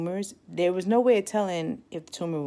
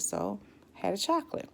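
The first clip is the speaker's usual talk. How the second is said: usual speaking voice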